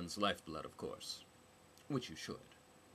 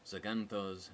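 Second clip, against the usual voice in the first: ordinary delivery, nothing marked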